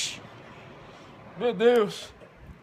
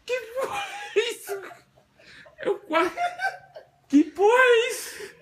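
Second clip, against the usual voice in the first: high frightened laughing cries; scared horror game streamer; 14 semitones higher than usual. Real, not acted. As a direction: shriek in nervous laughing panic.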